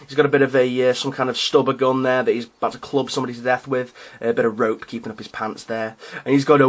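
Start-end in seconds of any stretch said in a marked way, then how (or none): none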